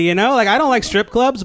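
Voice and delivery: normal talking voice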